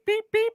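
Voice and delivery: high electronic beep beep; imitated beeping. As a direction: chirp like a charger. Mimics the charger's finished beeps.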